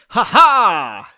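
loud, high mock ha ha; Henry's laugh. Henry laughing at Pauline, loud staged ha ha. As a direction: bark out a quick stagey laugh. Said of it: "That was his laugh".

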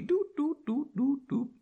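high little "doot" placeholder noises; sing-song doots while searching; idle doot-doot. Real, not acted. Reader looking for their place, idly dooting.

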